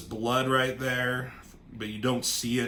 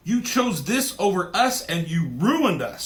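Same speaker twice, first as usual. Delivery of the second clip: a louder, higher accusing yell; retold yell; 6 semitones higher, 6 dB louder than usual. Wanda yelling at her father Magneto, louder and higher. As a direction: hurl the accusation at him. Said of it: "she yells at him".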